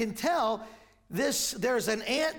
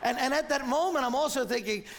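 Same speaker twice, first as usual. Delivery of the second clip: ordinary talk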